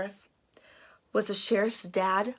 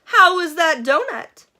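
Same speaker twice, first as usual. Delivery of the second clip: Missus Flaherty; 8 semitones higher, 7 dB louder than usual. Acted.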